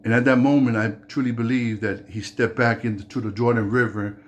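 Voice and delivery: ordinary talk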